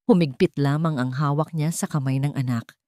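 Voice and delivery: usual speaking voice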